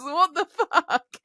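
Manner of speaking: slow, laughing what the fuck; laughing disbelief. Real, not acted. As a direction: stretch it out through a laugh. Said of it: "laughing disbelief".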